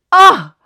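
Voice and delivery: loud, high "ah"; sudden loud wounded exclamation; pained cry. Pretend. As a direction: cry out as if stabbed. Reacting as if stabbed by words.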